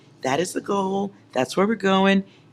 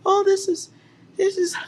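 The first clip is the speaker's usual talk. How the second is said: mock crying. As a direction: fake a teary sob for show. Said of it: "Bubble bath and tears".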